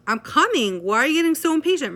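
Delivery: high, loud, fast defensive yell; exasperated shout. Acted. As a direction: escalate the fight. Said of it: "might have an extreme reaction to that".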